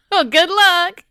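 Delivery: high, loud Southern farewell; cheery country drawl; Southern accent. Acted. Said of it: "all of the knights are Southern now".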